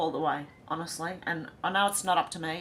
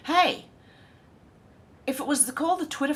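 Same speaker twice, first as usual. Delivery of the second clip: excited; 8 semitones higher, faster than usual. Real, not acted. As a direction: burst out with a new idea. Sudden idea, calling out.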